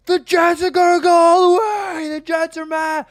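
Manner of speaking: reliving a crazed camera scream about the Jets; screaming like a lunatic. Retold or imitated. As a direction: let it rip like you blacked out. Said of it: "I just start yelling".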